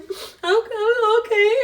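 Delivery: imitated tearful squeak, high and loud; mock crying, voice shooting up; fighting back tears. Wife answers husband who says don't cry, imitated tearful squeal. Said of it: "trying not to cry".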